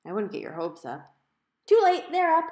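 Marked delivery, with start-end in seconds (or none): 1.7-2.4 high, loud and eager; a bouncy kid blurting it out; excited little sister. A narrator's reading. Little sister gleefully answering her brother, high and loud.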